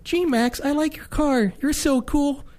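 put-on high, eager little kid voice; whiny kid begging for attention; pestering child.

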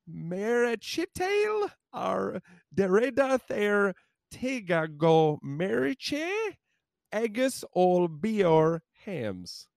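slow, high, halting Irish Gaelic; struggling through bad Irish Gaelic; botched Irish Gaelic. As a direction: sound out mangled Irish Gaelic slowly, pitched high. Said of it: "Irish Gaelic here".

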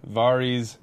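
"Varys" said as VAR-eez; third pronunciation variant. Shown as a demonstration. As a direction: open the first vowel of "Varys" to AH. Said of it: "chatter about the pronunciation".

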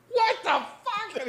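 very high, slow stunned squeal; shocked disbelief. Retold or imitated. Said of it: "And I was like".

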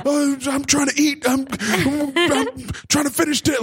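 high mock grumble; hammed-up cranky father; grumbling dad. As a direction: complain like the interrupted father. Dad grumbling, voiced in story.

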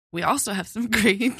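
breaking into laughter mid-read; laughing. Real, not acted. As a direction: read collapsing into giggles. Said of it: "I have a condition".

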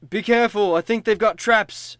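high, loud urgent call; urgent warning. Acted. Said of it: "he says".

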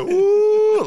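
high loud howl; wolf howl. Retold or imitated. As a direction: howl it out long. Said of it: "just mixing it together".

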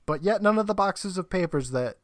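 usual speaking voice

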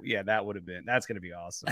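usual speaking voice

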